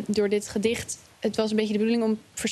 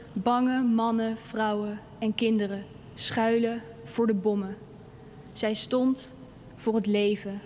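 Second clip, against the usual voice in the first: measured, solemn poem reading with long pauses; poem recitation; slower than usual. Acted. Girl recites her poem about freedom on the empty Dam, solemn and measured. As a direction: recite it phrase by phrase, letting each land.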